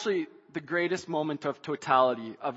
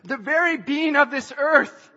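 building sermon; 8 semitones higher, 9 dB louder than usual. Acted. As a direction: preach it higher and louder until you're shouting. Preacher builds to climax.

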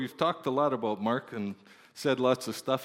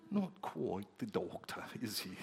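put-on British accent; 4 semitones lower, 11 dB quieter than usual. British congregation sizing up the new American pastor, British accent. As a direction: draw it out softly in a refined British accent. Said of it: "they could say it with such a nice accent".

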